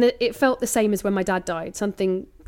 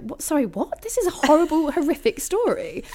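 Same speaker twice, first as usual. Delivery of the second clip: higher mock-appalled outburst, laughing at the end; shocked disbelief; 8 semitones higher than usual. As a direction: play it horrified but amused. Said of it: "and you're like".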